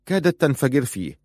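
ordinary delivery, nothing marked

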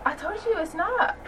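high, laughing complaint; high whiny protest. Real, not acted. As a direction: whine it up high. Teasing protest at friend.

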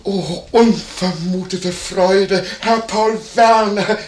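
high, slow, gushing welcome; effusive delight. Acted. Landlord greeting Paul Werner unexpectedly, effusive delight. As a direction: stretch every word with overdone joy.

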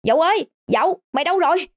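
high, frantic call from afar; high-pitched panicked boy calling. A narrator's reading. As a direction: call out in alarm for a missing friend. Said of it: "tiếng gọi hốt hoảng từ xa".

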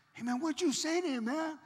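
high, loud pestering question; eager bystander. Retold or imitated. Mechanics crowd round asking what was said, excited high voice.

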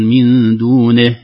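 "دونه" slow, with a full kasra on the nun; "من دونه" drawn out, the kasra fully sounded; clear kasra. Shown as a demonstration. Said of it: "نه وليس نه". (تحقيق كسرة النون في "دونه")

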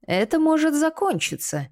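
menacing growl from the torturer; growled threat. A narrator's reading. Torturer offers Kinta an end to pain, growled. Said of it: "прорычал Дэймин".

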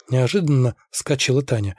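usual speaking voice

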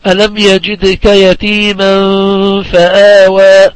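slow recited verse with drawn-out vowels; Quran recitation. Shown as a demonstration. Teacher recites a verse as a tajweed example, slow measured recitation. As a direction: recite it slowly, holding the long sounds.

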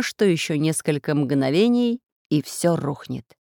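usual speaking voice

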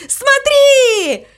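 very high, excited shout. A narrator's reading. Mama Mu shouts to the crow mid-leap, very high. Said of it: "прокричала мама Му".

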